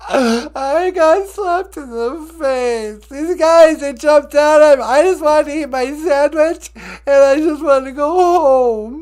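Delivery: whiny Juicy Smollett. Acted. Juicy whining about attack.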